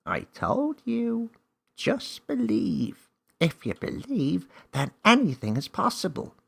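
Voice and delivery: small wise creature explaining the rules; magical helper creature. Acted. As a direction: play a confident little magical guide. Name reassures Millie.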